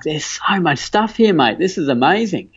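mock excited specialist marveling at scans; excited doctor. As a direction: gush over the scans like a keen specialist. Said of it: "this dude who's all excited".

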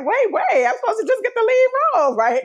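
high, fast incredulous squeak; naive disbelief. Retold or imitated. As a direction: play the shocked graduate. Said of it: "I was like".

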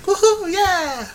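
high-pitched mock whoop; imitated crowd cheer. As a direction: cheer like a whole crowd going wild.

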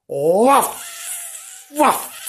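high playful barks; puppy woof. Acted. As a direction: bark it like a pup. Dog barking words.

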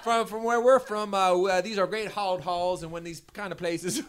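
high-pitched, hammed-up Newfoundland accent. Resuming a singer's speech on request, mock Newfoundland accent. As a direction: commit to a mock Newfoundland accent, laughter breaking in. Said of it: "continue on with the accent".

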